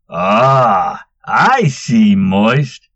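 loud gloating tease; crowing. A narrator's reading. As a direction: crow in triumph. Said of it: "Gunther crowed".

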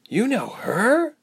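high, slow surprised question; taken-aback query. A narrator's reading. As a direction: draw it out in astonishment, rising at the end. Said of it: "Ilse asked with surprise".

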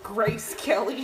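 "Grace Kelly" echoed with a laugh. Pretend. Echoing the joke, laughing.

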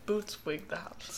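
a soft hush that ends in a whisper; a breathy secret whisper; whispered catchphrase. Pretend. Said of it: "quiet whispered catchphrase".

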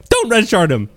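high, urgent imitated plea; mock pleading. Nessie stops the others attacking, high mock plea.

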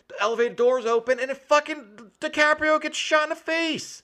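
rising excitement at the shocking moment; excited telling. Real, not acted. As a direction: tell it like you can't believe it happened. Excited retelling of shock scene.

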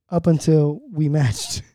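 normal talking voice

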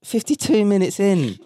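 outraged at a story held back for the whole episode; exasperated. Real, not acted.

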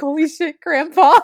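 high startled exclaim; shocked. Retold or imitated. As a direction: gasp it out, spooked. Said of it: "You're like".

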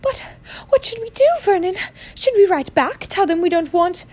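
very high, tearful aunt voice; anxious aunt. Acted. Aunt asks her husband what to do, high and anxious. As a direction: fret in a high, wailing voice.

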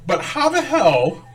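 high, slow and laughing; incredulous. Real, not acted. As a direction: ask it in disbelief.

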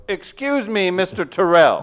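high, loud, stiff formal voice; mock formal address. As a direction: say it like a snooty official.

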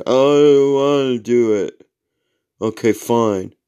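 put-on long dragged-out teenage whine; imitated reluctant teen. Seventeen-year-old son refusing a chore, reluctant whine. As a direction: drag it out like a sulky teenager. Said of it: "He's seventeen".

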